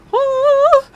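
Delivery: high, loud "woo"; celebratory whoop. Real, not acted. Host celebrating Friday with viewers, excited whoop. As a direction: let out a high, sustained whoop. Celebrating reaching the end of week.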